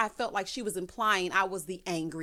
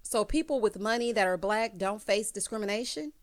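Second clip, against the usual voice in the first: ordinary delivery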